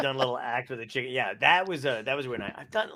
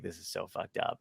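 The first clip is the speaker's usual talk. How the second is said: softer, lower disbelieving mutter; muttered aside; 6 semitones lower, 10 dB quieter than usual. Retold or imitated. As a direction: mutter it under your breath, lower. Inner reaction at pig roast.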